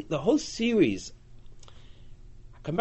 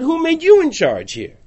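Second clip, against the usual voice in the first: higher, louder defiant challenge; indignant demand, raised and loud; angry challenge; 11 semitones higher, 8 dB louder than usual. Acted. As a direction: throw it at him as an insult. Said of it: "they come to Yiftach and said".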